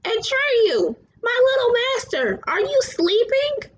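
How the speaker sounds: high ringing dragon voice; high, bell-like voice of the luck dragon; bronze bell tone. A narrator's reading. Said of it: "Falkor's bronze bell tone rang out".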